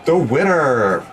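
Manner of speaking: stretched announcer call. Acted. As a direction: announce the winner. Announcing contest winner.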